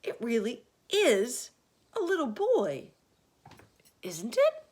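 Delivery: high, loud disbelief; can't believe his eyes; amazed. A narrator's reading. Fire chief gasps at the little boy, high and loud.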